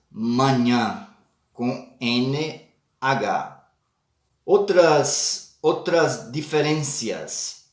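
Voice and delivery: ordinary delivery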